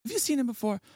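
mildly acted puzzled aunt; retold Aunt Snooki. Aunt asking grandmother if she's seen him, acted puzzlement. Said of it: "she goes".

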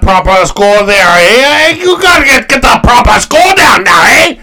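loud, high mock Montreal Canadian accent; comic Montreal accent, building to a high yell. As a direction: shout it in a hammed-up Montreal French-Canadian accent. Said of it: "Montreal Canadian".

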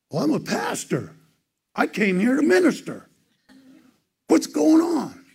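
high, loud mock wail, rising as it goes; imitated bawling. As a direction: retell a man bawling in protest, pitch climbing. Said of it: "he starts bawling, and he says".

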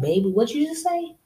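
high, mocking "what you just say"; sassy challenge. Woman daring a threatening boyfriend to repeat it, sassy and high. Mock reply to threatening man.

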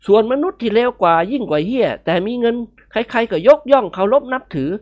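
wry musing voice, half joking; mock-serious young man. Nikorn musing wryly to friends about the lizard. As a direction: lament the lizard's fate with a sigh.

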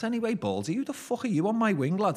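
loud, high-pitched mock challenge; re-enacted jeering banter shout; cocky challenge. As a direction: call him out, pitched right up. Retold cheeky challenge to the governor.

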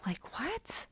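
high, breathy incredulous question; can't believe what you just heard; stunned disbelief. Real, not acted. Recapping the show to listeners, high incredulous whisper. Disbelief at Danielle's breakup plan.

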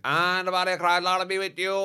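high, loud priestly chant; mock priest intoning. Altar boy mocking the priest at mass, hammed-up chant. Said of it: "he'd be like".

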